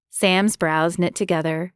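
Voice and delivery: plain reading voice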